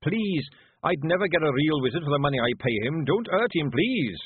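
frantic pleading. Acted. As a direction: plead for the boy, fast and scared. Zack Zack begging.

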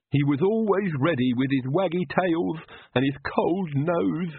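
sobbing Igor. Acted. Igor mourning his dog to Nanny, tearful servant voice. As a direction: choke it out through tears. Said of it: "Igor sobbed".

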